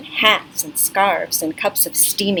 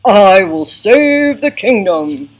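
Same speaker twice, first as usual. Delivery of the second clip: slow, loud, proud lion roar of a voice; loud noble lion; brave lion; 9 dB louder, slower than usual. Acted. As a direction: boom it out like a heroic lion king. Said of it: "The lion says".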